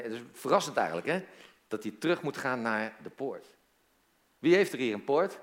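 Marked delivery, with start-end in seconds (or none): none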